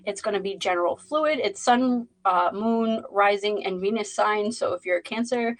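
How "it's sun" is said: ordinary talk, nothing marked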